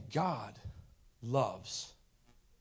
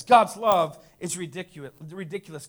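usual speaking voice

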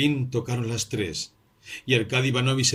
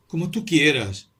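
higher, louder, slow eerie repeat; mysterious tone; 6 semitones higher, 5 dB louder than usual. A narrator's reading. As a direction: stress tú, strange and knowing. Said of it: "en un tono misterioso".

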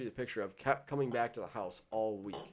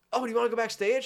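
high, louder, bright offer; high mock friendly performer; 11 semitones higher, 7 dB louder, faster than usual. Performer inviting the roommate backstage, high mock voice.

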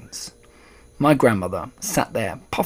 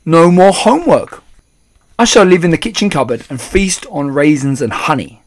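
put-on loud, high boyish delight; gleeful mouse boy; 5 semitones higher, 10 dB louder than usual. A narrator's reading. Mouse boy relishing his new life, gleeful. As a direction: crow about it happily.

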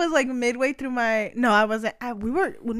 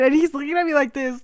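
ordinary talk

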